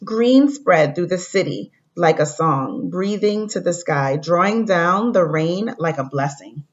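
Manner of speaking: storybook read-aloud to the class; reading to children. Acted. Teacher reading a story to fourth graders, gentle read-aloud. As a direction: read it like a picture book for kids.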